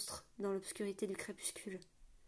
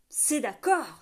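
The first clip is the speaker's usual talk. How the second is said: cried out; 3 semitones higher, 8 dB louder, much slower than usual. A narrator's reading. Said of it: "s'écria l'autre homme".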